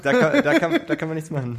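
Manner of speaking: words through high laughter; laughing. Real, not acted. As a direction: laugh while trying to talk.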